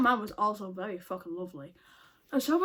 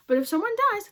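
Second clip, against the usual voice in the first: higher, louder, mock warning; indignant retort; 10 semitones higher, 5 dB louder, faster than usual. Refusing blame to the coworker, higher and louder. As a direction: pitch it up and raise the voice in protest.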